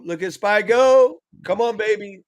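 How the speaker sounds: drawn out high and loud; excited. Real, not acted. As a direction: cheer the chart on slowly. Excited at the market.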